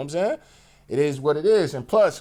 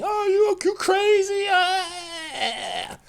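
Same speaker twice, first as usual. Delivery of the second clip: much higher mocking girlish voice; girlfriend's brush-off; much slower than usual. Mocking his girlfriend brushing him off, high dismissive voice. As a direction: mimic her dismissive squeal up high. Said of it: "she do the whole women thing".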